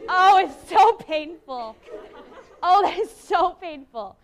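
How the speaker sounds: loud, laughing groan of pain; drawn-out, laughing complaint; amused groan. Real, not acted. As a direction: moan it loudly through laughter. Groaning at the dull reading.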